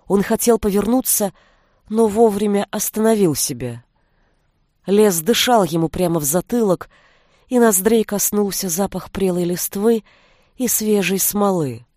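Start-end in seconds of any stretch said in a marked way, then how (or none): none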